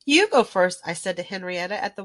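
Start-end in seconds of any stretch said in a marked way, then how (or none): 0.0-0.8 loud offer. A narrator's reading. Offering first turn.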